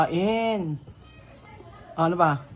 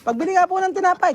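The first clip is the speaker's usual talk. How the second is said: higher-pitched bread buyer's call; calling to a shopkeeper; 10 semitones higher, 3 dB louder than usual. Shown as a demonstration. Mother shows child how to buy bread, calling out. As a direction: call out lightly to be served.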